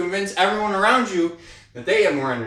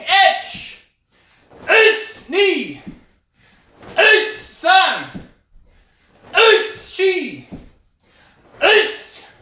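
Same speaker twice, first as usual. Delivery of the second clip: sharp, high shouted counting; kiai count shouts; 14 semitones higher, 6 dB louder, much slower than usual. Real, not acted. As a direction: bark each count out with power. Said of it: "I try to get louder".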